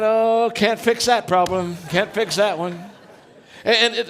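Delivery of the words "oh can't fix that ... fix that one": louder, slow, chuckling; amused mock despair; laughing resignation. Real, not acted. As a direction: laugh through the punchline. Punchline about golf problem.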